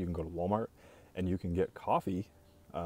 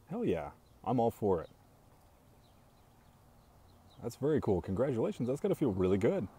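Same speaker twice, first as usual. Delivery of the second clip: higher, louder, genuinely thrilled; excited congratulations; 5 semitones higher, 3 dB louder than usual. Real, not acted. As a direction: cheer them on warmly. Congratulating a viewer live.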